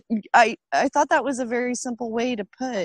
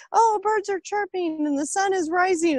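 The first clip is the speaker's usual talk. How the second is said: mock cheery; 7 semitones higher than usual. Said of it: "I try to wake up and".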